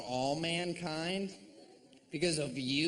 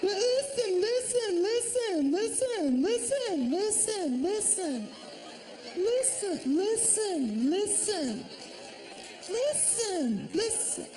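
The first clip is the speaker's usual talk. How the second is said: imitating nagging girlfriend; slower than usual. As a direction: whine it like a needy girlfriend.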